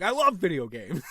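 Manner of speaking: loud, high mock-defensive insistence; mad denial. Mad player answering his wife, loud insistence. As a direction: insist it angrily, hammed-up. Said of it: "you seem really mad right now".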